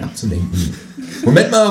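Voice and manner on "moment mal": loud, high call of protest. Acted. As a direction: stop them short with a loud call. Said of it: "rufe ich".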